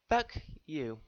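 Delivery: deliberate, pointed delivery; a flat, pointed curse to the villain; blunt insult. Real, not acted. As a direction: spit it straight at her. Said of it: "I have one last message for her".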